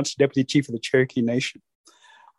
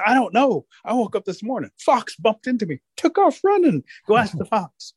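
high-pitched storybook squirrel; pitched-up, flustered reply; excited animal character; 8 semitones higher than usual. Acted. Squirrel explains to Rabbit why he's running, excited.

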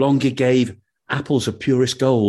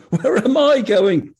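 put-on cheeky young man; 10 semitones higher, 4 dB louder than usual. Young man's cheeky reply.

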